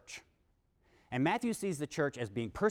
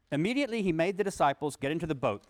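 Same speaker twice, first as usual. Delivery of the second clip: raised, deliberate reading voice; louder scripture reading; 3 semitones higher, 4 dB louder than usual. Acted. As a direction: read the gospel text out to the class. Reads scripture passage aloud.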